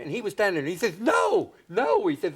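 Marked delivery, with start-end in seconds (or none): 1.0-2.1 high, giggly comic voice; much higher, a silly old comedian's protest; mock Ed Wynn waving it off; imitating Ed Wynn. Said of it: "Is that how he talks?".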